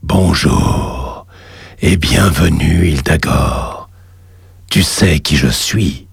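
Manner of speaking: deep, slow cavernous voice; underworld god. Acted. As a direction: speak low like a god from the lava. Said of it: "une voix caverneuse se fit entendre".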